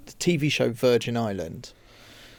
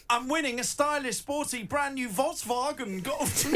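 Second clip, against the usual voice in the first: high, soft, hammed-up King Julian voice on Volkswagen; giggling through a King Julian accent; higher and quieter King Julian accent, laughing; 10 semitones higher, 6 dB quieter than usual. Shown as a demonstration. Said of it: "doing it like King Julian".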